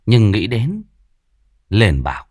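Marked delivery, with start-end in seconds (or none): none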